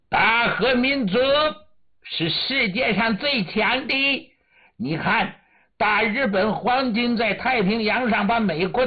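high, haughty commander with a womanish voice; arrogant, womanish voice. Acted. Japanese commander boasts to his host, arrogant womanish voice. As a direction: pitch it up high and boast imperiously. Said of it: "女人的嗓音傲慢地说".

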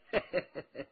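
quiet chuckle fading off; soft amused laugh. Acted. Officer laughing at old Wang, softly amused.

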